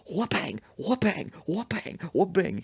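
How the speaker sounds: high "whoopang" in a volley; repeated smack sounds. Retold or imitated. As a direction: fire off cartoon blows one after another. Imitated blows back and forth.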